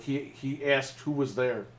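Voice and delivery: ordinary talk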